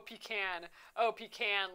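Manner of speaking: "pecan" as pee-KAN; pronunciation like "can". Shown as a demonstration. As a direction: say "pecan" ending exactly like tin can. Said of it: "like can".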